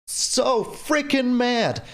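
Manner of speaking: drawn-out, stressed freaking; slow seething complaint; slow frustration. Real, not acted. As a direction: stretch it out, fed up. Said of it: "I'm so mad".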